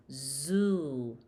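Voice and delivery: "zoo" with a long oo; thick buzzed "zoo", vowel held. Shown as a demonstration. Teacher adds o to the letter, long zoo. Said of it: "we will add o".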